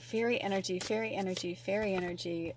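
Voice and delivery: soft, even chant under the voice; quiet repeated murmur. Real, not acted. Reader murmuring over the cards for fairy energy, quietly. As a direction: repeat it softly to yourself.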